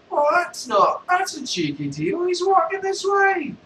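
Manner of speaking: very high, excited beggar voice; a squeaky bum, amazed. Acted. Beggar answers Vader.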